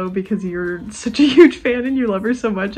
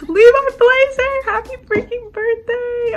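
high, loud, drawn-out cheer; excited birthday shout; 6 dB louder, slower than usual. Real, not acted. Friend cheering Levi's birthday, high loud excited squeal. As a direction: burst in squealing his name with joy.

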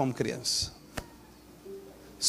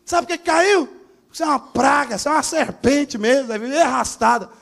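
hammed-up screaming father, much higher and louder; mock furious father; 10 semitones higher, 5 dB louder than usual. Pastor mocks an angry dad scolding his fallen child, shouting high. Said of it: "Aí o papai chega e fala".

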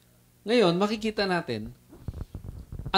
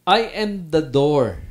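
slow and louder, each word weighed; emphatic quote; 5 dB louder, slower than usual. Shown as a demonstration. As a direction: press every word of "I am the door".

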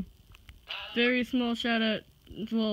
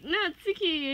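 ordinary delivery

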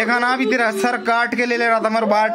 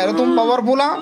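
ordinary talk, nothing marked

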